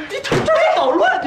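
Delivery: sudden high furious yell; angry outburst. Acted. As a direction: snap at him in rage. Heated quarrel over gold.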